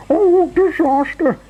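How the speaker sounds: very high, loud, drawn-out wail; terrified scream. A narrator's reading. Mister Jelly sees the tramp's face, screaming. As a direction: scream it slowly in squeaky panic. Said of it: "he screamed".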